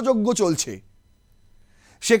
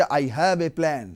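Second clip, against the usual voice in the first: very slow, lower grand declaration; mock oratory; 6 semitones lower, much slower than usual. Commentator mocking Tarek Rahman's speech, slow and lower. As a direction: drag it out like a historic speech. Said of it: "বাংলাদেশে এসে বললেন যে".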